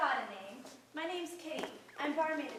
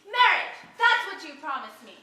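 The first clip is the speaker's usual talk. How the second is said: angry demand; 2 semitones higher, 9 dB louder than usual. Acted. Cast-off lover demanding promised marriage, angry and loud. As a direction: throw the promise back at him.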